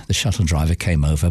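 usual speaking voice

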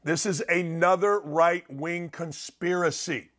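slow, deliberate and measured; slowly, so the important point lands; saying it slowly. Real, not acted. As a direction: spell it out slowly, word by word. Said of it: "I'm gonna say this slowly".

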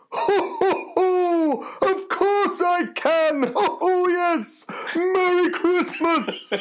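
booming falsetto ho-ho-ho, drawn out; very high, slow, jolly Santa with big belly laughs; Father Christmas voice. Acted. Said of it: "I turned the voice on".

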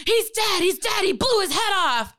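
high, frantic alarm, acted out; frantic man reporting a death, imitated. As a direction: blurt it in a mimicked high-pitched panic. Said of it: "he was really frantic".

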